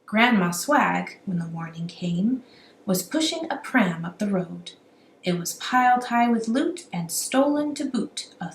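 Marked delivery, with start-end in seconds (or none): none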